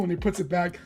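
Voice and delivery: ordinary delivery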